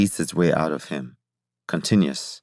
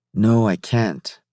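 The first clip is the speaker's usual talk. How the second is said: higher, drawn-out insistent refusal; slow, raised-pitch insistence; 5 semitones higher, 4 dB louder, much slower than usual. A narrator's reading. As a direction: dig in and refuse, stretching it out slowly. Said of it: "Jacob insisted".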